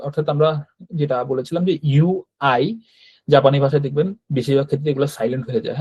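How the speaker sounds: usual speaking voice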